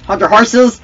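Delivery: high, loud playful shout; loud call. Pretend. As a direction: holler it to halt the opponent. Calls out in play.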